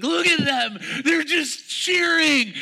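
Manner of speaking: imitating a devastated child, very high; high, slow wailing kid. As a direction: whine it like the whole world ended. Boy points at cheering winners.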